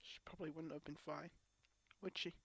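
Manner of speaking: the fight gone, conceding to herself; hushed resignation trailing into a whisper; drained, fading to a whisper. Real, not acted. Quiet resignation after outburst.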